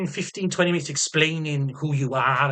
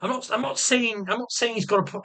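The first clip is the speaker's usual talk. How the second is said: usual speaking voice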